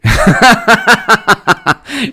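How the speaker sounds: high burst of laughter. Real, not acted. Streamer cracking up at a chat comment, high laughter.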